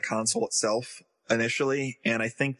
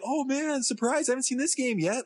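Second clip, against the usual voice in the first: much higher, put-on amazement; mock surprise; 10 semitones higher than usual. Host imitating players seeing next year's games, mock surprise. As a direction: imitate players pleasantly caught off guard, pitched high.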